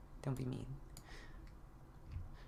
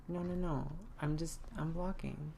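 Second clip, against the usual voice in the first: usual speaking voice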